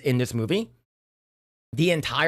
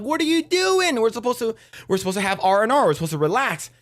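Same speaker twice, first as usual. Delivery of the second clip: high, fast, startled protest that fades down; shocked protest; 7 semitones higher than usual. Retold or imitated. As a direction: blurt it out in disbelief. Bandmates upset about the leak.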